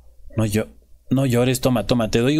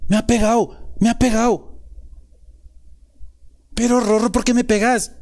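whiny complaint; 9 semitones higher than usual. Pretend. [name] whines to Rorro after she hits him, high and wounded. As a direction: whine like a beaten kid.

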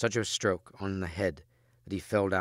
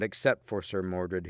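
usual speaking voice

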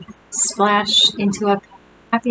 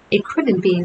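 plain reading voice

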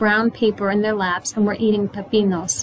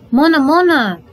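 put-on high, loud little girl's call; child calling out; 6 semitones higher, 6 dB louder than usual. A narrator's reading. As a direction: cry out urgently for mother. Little girl calls out to her mother.

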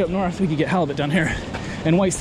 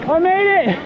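high, drawn-out victory call; triumphant shout; 12 semitones higher, 4 dB louder, slower than usual. Real, not acted. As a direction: holler it to your friends across the rocks. Calls out after crossing rocks.

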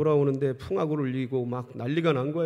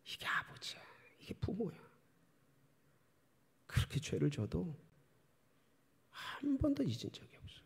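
whispered, much softer; hushed whisper; 4 semitones higher, 12 dB quieter than usual. Real, not acted. Preacher tells congregation what a father is, in a whisper. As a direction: breathe it as an intimate secret.

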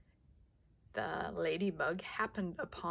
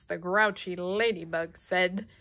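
ordinary delivery, nothing marked

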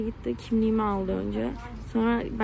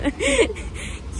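nervous laugh; 10 semitones higher than usual. Real, not acted. Laughing at her bad luck.

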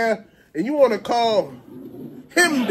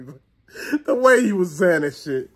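ordinary talk

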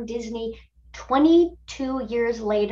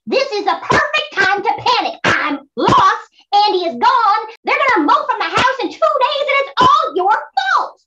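high, loud panicked yelling; furious shout; 11 semitones higher, 6 dB louder than usual. Acted. Toy panicking at his partner, furious shout. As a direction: scream blame at him, near tears.

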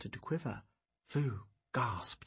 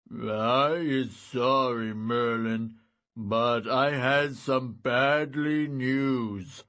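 loud, slow lumbering giant; slow simple-minded giant, glum; 6 dB louder, much slower than usual. Acted. Giant Shim speaking.

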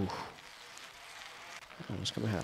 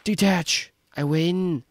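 much higher, much louder victory yell; triumphant shout; 10 semitones higher, 8 dB louder, slower than usual. Real, not acted. Reaches the finish, wins.

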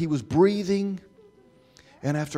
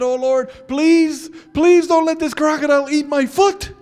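desperate prayer; 13 semitones higher, 12 dB louder than usual. Retold or imitated. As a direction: beg God urgently. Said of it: "I prayed".

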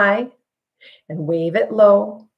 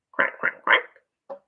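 whispered quack; 4 dB quieter, much slower than usual. Pretend. Duck noise in recap.

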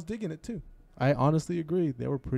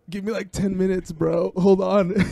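casual stoner drawl, louder; laid-back musician voice; 7 semitones higher, 7 dB louder than usual. Retold or imitated. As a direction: play the chill bandmate. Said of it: "He goes".

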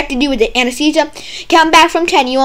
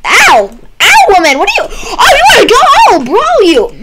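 higher, louder hammed-up yelling; mock angry shout; 11 semitones higher, 8 dB louder than usual. Kicked patient yelling at the doctor, loud mock anger. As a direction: yell back like spoiling for a fight.

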